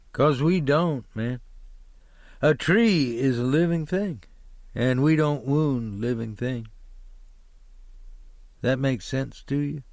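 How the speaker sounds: a touch of preaching about living things; earnest driver. Acted. Driver explaining to the hitchhiker they harm no trees, mildly earnest.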